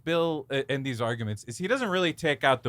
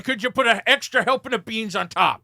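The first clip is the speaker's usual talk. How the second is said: hammy order; 8 semitones higher, 7 dB louder than usual. Pretend. Mock ordering extra beans.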